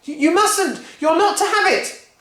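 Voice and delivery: very high, loud pleading; panicked boy. Acted. George refusing Grandma, panicked high cry. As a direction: pull away in panic.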